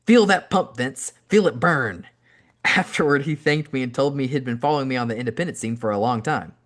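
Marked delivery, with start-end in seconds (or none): 0.1-2.1 loud gym-spotter yell, then a drop on the name; yelling encouragement. Retold or imitated. Spotting Vince on the bench, a loud encouraging yell. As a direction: pump him up like a spotter. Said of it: "by yelling".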